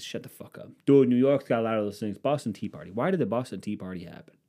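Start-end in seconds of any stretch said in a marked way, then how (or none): 0.0-0.7 very soft, under the breath; muttered retort. Acted. Muttered retort to imagined objector. 0.9-2.2 loud, insistent mock objection; louder mocked objection. Mocking objector again, louder second take.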